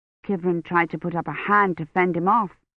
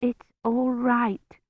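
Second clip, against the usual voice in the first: slow, weak and feverish; barely able to speak; 4 semitones higher, much slower than usual. A narrator's reading. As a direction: push the words out through the fever. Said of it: "she could hardly understand her own words".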